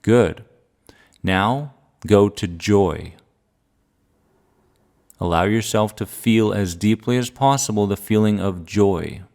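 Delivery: calm grounding exercise. Acted. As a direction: calmly guide each feeling, pausing long. Guides a feeling exercise.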